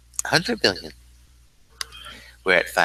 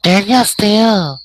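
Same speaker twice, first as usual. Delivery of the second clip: high, loud character shout; V Siri; 11 semitones higher, 8 dB louder than usual. Acted. Guest answering host's thanks, high loud character voice. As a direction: belt it out in a high put-on voice. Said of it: "Let's say hello to V Siri".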